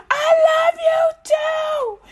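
very high, slow, loud mock sob; Jehovah crying back. Jehovah answers the worshipper, hammed-up tearful wail. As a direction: cry it like an overjoyed God. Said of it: "Jehovah's like".

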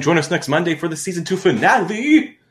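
big high-pitched hype on the sign-off; hyped announcer plug. Pretend. Co-host signing off to listeners, hyped-up finale announcement. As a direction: build up and ring out on the finale.

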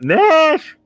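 high, loud scream; Walnut. Acted. Calling through the door to a sleeper, shrill scream. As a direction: shriek the name through a door.

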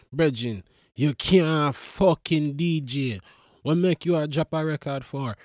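a hint of patois telling off a friend; mock Jamaican scolding. Comic telling off Popcorn in a mild Jamaican lilt. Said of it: "I said".

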